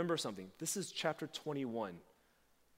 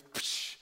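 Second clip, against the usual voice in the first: splash noise. Retold or imitated. Peter jumps into the sea.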